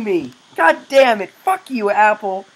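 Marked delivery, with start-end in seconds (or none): none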